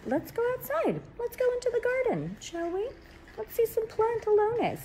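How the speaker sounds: very high, playful falsetto invitation; high swooping singsong. Pretend. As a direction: coax them outside in a silly high voice.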